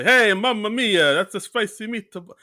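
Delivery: high, loud, stretched mock Italian accent; exaggerated Mario accent. Imitating stinky Italian mascot from the sketch, exaggerated Italian accent. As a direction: ham up a cartoon Italian accent. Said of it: "if you do an exaggerated Mario accent".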